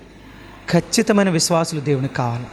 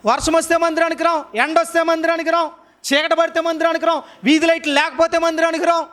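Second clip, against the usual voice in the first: high, sustained preaching pitch; impassioned preaching; 8 semitones higher than usual. Real, not acted. Preacher challenging those who skip church, impassioned. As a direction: drive each point home on a high, repeated cadence. Preacher in raised rhetorical run.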